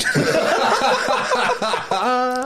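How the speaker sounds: squealing burst of laughter; high giggle. Real, not acted. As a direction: crack up high. Laughing at own story.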